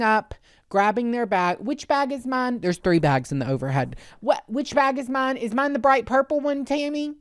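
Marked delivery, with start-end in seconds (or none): 1.6-2.6 higher, lightly mocking passenger voice; mimicked dawdling passenger. Voices dawdling passenger in overhead bins. 4.2-7.1 high-pitched mocking traveler voice; mimicked dawdling passenger.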